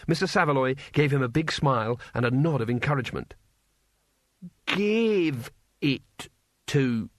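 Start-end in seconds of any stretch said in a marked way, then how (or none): 4.7-7.1 very slow, effortful word by word; strained, halting. A narrator's reading. Cohen struggles to say the civilized answer, halting. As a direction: force out each unfamiliar word. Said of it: "lips tensed around the word".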